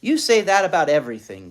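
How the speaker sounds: put-on fast, loud childish complaint; complaining. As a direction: whine it quickly back. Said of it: "complained Ramona".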